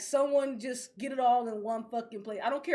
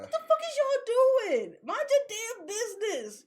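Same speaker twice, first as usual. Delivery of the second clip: outraged whine; 8 semitones higher than usual. Real, not acted. Ranting at the streaming services.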